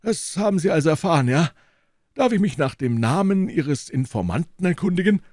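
put-on a lofty old professor receiving guests; old professor's voice. A narrator's reading. Elderly professor politely greeting visitors, high and measured. Professor questions Holmes suspiciously.

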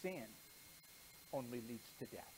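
ordinary talk

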